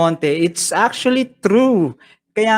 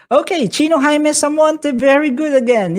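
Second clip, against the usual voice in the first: much higher and louder, thrilled; excited praise; 10 semitones higher, 4 dB louder than usual. Real, not acted. Teacher thrilled Chino answered right again, excitedly. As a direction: squeal with delight at the answer.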